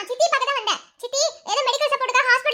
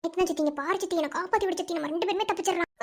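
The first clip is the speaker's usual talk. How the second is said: hushed plea; 5 semitones lower, 8 dB quieter than usual. Acted. Daughter begs aunt under breath.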